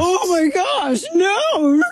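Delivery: high-pitched, drawn-out wail of fake outrage; mock horror. Host mocking outrage over a chugged beer, high mock wail. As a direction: cry out in hammed-up scandalized shock.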